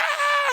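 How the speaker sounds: piercingly high, loud fake scream; mock scream. Imitating a wrestler fleeing through the door, mock scream. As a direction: scream like a panicking horror victim, hammed up. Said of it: "He's screaming".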